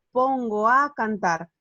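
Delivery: pongo a cantar slow, the o and a blended into one syllable; joined vowels. Shown as a demonstration. Teacher showing the sinalefa, slow and joined. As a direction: glide pongo into a slowly. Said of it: "está marcado en verde".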